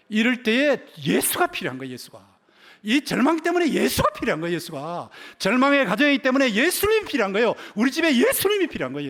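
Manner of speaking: high, loud pulpit insistence; full-force preaching. Acted. Preacher urging that a despairing home needs Jesus, high insistent preaching. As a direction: drive each plea home, letting repeats drop.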